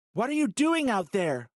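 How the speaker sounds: high, loud alarmed yell. Acted. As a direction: yell across the radio. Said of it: "Holly yelled".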